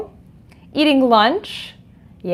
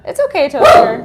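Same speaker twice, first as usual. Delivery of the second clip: calming the dog; 4 semitones higher, 7 dB louder than usual. Real, not acted. Soothing barking dog.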